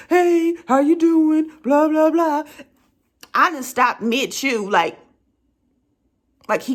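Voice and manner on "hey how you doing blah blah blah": slow, louder friendly man's voice; drawn-out hammed-up greeting to a dog. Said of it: "he's sitting there talking to Samson".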